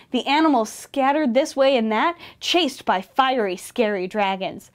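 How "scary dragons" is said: plain reading voice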